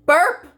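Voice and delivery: loud high comic burp sound. Acted. The book burps after eating them, loud.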